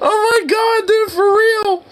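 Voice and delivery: high, breathless laughing shriek; a real high-pitched fit of laughter. Real, not acted. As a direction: laugh helplessly up in a high register. Laughing hard at kitten photos.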